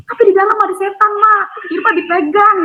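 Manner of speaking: a girl reporting the ghost, mock frightened; frightened child crying out. Girl tells her mother about the ghost, high and hurried. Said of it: "aku teriak".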